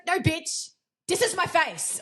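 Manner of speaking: sassy retort. Acted. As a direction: push back with mock indignation. Acted retort to body shaming.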